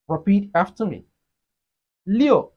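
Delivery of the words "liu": "Liu" high and emphatic, then dropping; falling tone. Shown as a demonstration. Teacher models Chinese six for learners, falling "Liu". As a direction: punch "Liu" and let the pitch fall. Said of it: "It has the falling tone".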